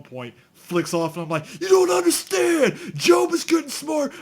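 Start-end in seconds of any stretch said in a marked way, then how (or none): 1.6-4.1 much higher, loud panicked British shout; British yell. Retold or imitated. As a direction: shout it in a frantic British accent. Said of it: "Yells in British".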